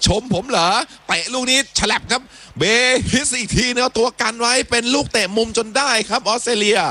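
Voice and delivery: rising, excited commentary as the attack builds; live play-by-play. Real, not acted. Commentator calling a left-wing attack to listeners, excited play-by-play.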